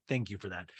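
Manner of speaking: low, soft deadpan; flat it down, unimpressed; dry Garcelle. Retold or imitated. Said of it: "Garcelle's like".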